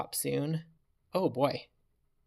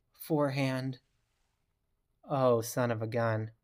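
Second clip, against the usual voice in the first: normal talking voice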